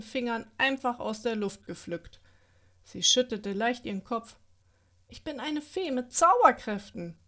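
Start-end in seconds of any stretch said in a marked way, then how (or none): 5.1-7.2 higher, resolute self-assurance; firm, determined. A narrator's reading. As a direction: say it to convince herself. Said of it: "sagte Alina bestimmt".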